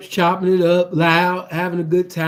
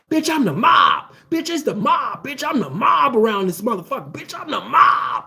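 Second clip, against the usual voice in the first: Bay Area tough talk; 3 semitones higher than usual. Retold or imitated. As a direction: puff up like a Bay Area hustler.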